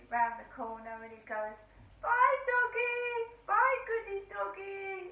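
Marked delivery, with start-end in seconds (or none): 2.0-5.1 much higher and slower, sing-song goodbye; imitating the driver's high, slow baby talk to a dog. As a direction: drawl a cooing farewell to the dog. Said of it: "talking like that".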